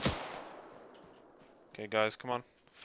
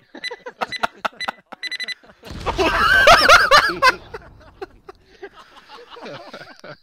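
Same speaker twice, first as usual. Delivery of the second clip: high, loud burst of laughter; shrieking laugh; 9 dB louder than usual. Real, not acted. Big laugh after IED joke.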